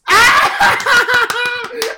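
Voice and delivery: loud delighted shriek of laughter. Real, not acted. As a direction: scream with laughter. Reacting to news.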